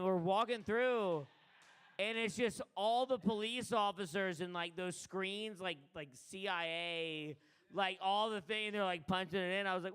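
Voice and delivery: usual speaking voice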